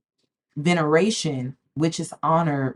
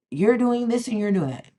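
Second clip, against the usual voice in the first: higher-pitched mimicking of critics; mock accusing quote, pitched up; mimicked accusation; 5 semitones higher than usual. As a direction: imitate a nagging accuser in a higher voice.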